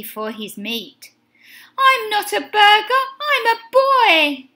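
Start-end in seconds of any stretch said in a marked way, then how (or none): 1.8-4.5 high, loud protest; shout. A narrator's reading. Benny to the dog chasing him, high protesting shout. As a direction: shout it out in a raised pitch. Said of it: "shouted Benny".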